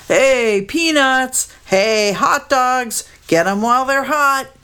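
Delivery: high, drawn-out ballpark hawker's cry; vendor's call. A narrator's reading. Stadium hawker selling snacks to the crowd, high calling. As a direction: sing out the wares over the crowd.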